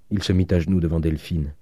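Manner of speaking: plain reading voice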